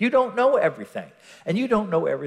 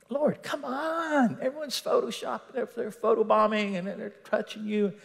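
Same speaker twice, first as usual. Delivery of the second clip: exasperated protest; 4 semitones higher, 3 dB quieter than usual. Acted. Disciples objecting to Jesus about the crowd, exasperated. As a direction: plead in a high voice as a baffled follower.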